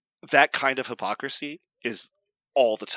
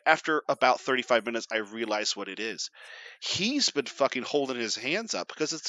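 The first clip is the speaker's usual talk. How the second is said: ordinary delivery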